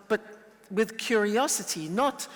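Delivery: ordinary talk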